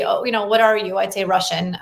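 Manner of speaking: normal talking voice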